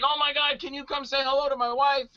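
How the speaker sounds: high-pitched eager pleading, imitated; mock starstruck fan. Fan asking the actor to greet his wife, excited. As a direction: gush the request like a thrilled fan. Acting an excited fan's request.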